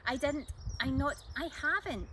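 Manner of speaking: flustered, tumbling denials; a mild splutter. Acted. Jack protesting to Kelly, mildly flustered splutter. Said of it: "Jack spluttered".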